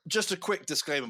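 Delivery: ordinary talk, nothing marked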